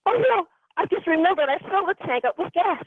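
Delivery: yelping as she remembers the full gas tank; sudden alarm. Retold or imitated. Dazed driver suddenly recalls full gas tank, alarmed yelp. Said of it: "I'm like".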